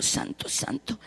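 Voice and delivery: faint reverent whisper; almost silent whisper; whispered worship. Real, not acted. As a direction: breathe the words in awe.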